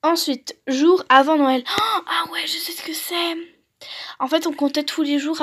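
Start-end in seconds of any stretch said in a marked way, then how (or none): 1.7-3.4 whisper. Real, not acted. Reacting to the worksheet to herself.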